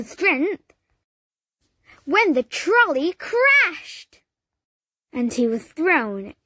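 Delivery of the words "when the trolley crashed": ordinary delivery, nothing marked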